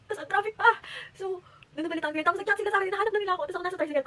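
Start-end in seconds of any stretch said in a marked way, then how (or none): 0.1-0.7 panicked. Retold or imitated. Panicked retelling, cry heard.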